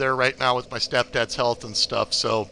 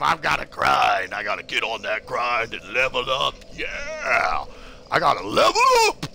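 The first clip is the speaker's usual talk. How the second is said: pumped up; 4 semitones lower than usual. Real, not acted. Hyping himself up.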